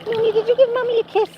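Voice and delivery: high cooing pet voice. Real, not acted. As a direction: ask the dog sweetly. Baby talk to dog.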